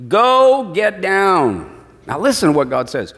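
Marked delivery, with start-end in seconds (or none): 0.1-1.8 very slow, drawn-out command; dramatic reading. Acted. God orders Moses down the mountain, drawn out slowly. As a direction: stretch every word of the order. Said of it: "does that sound better".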